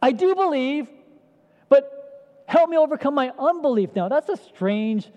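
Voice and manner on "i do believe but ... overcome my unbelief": loud, much higher desperate cry; cries out. Acted. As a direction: cry out to Jesus, pleading hard. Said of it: "So the father cries out".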